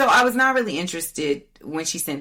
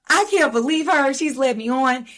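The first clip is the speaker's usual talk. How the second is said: higher, louder, hammed-up teary complaint; mock sobbing rant; 11 semitones higher, 5 dB louder than usual. Hammed-up teary rant, mocking the sobbing date on the phone. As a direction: parody her wailing, pitched up and loud. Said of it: "she is just crying and sobbing".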